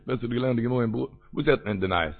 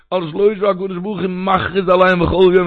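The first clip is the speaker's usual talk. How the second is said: emphatic, slightly raised proclaiming voice; public proclamation; 4 semitones higher, 6 dB louder than usual. Acted. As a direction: announce it as if into a microphone. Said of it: "ער נעמט א מייק, ער רופט".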